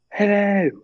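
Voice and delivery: high-pitched mock British greeting; put-on British accent, high and drawn out; Harry Potter accent. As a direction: imitate a British wizarding shopkeeper, pitched high. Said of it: "like, the Harry Potter accent".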